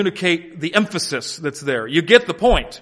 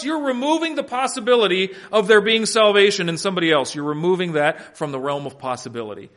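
normal talking voice

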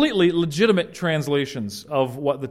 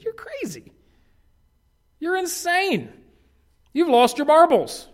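much higher, squeaky taunt; mocking accuser; 14 semitones higher, 3 dB louder than usual. Someone mocking a believer as crazy. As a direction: jeer at him in a silly high voice. Hypothetical insulter.

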